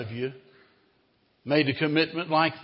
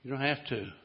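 soft, low gentle answer; calm him quietly; gentle reply; 3 semitones lower, 8 dB quieter than usual. Acted. New owner answering the defiant slave, soft and gentle. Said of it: "the man looked at him and said".

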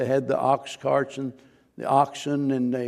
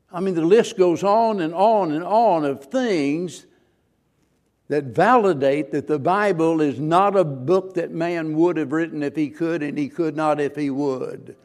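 preaching at full force; 2 semitones higher, 5 dB louder than usual. Acted. Preacher pressing the skeptics in his church, loud and rising. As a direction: build the case to the congregation.

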